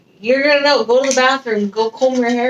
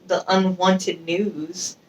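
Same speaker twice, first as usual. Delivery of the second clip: normal talking voice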